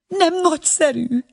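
high, delighted question; a mother thrilled by the invitation; lightly voiced gushing excitement; enthusiastic. A narrator's reading. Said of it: "kérdezte lelkesen az asszony".

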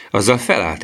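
plain reading voice

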